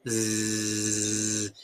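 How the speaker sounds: long, low, steady zzz; mosquito buzz. Shown as a demonstration. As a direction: hold a mosquito's drone. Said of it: "un mosquito, mejor diciendo". (zumbido sostenido de mosquito)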